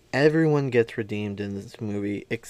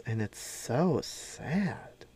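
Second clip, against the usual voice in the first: whispered aside; 10 dB quieter, much slower than usual. Real, not acted. Sad remark about hopeless kids.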